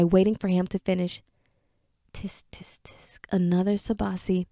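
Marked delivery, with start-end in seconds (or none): 2.1-4.5 unvoiced, disapproving whisper; whispered tut. Mesric whispering disapproval over another Sabasi. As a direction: tut to yourself over the pot.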